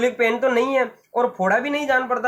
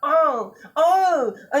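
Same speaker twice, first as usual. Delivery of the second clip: pained cry; 5 semitones higher than usual. Acted. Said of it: "Mohan तो चिल्लाता".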